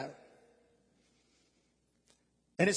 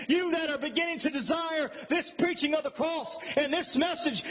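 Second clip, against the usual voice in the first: raised, insistent pulpit voice; impassioned preaching; 5 semitones higher than usual. Real, not acted. Preacher thanking the church for serving, impassioned preaching. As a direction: drive each phrase home like a preacher.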